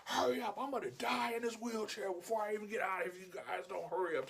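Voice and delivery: put-on high, griping old-man holler; whine-yell like a fed-up old customer; imitated impatient yelling. Old man in wheelchair rushing the shoppers, mock yelling. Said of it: "He's, like, yelling".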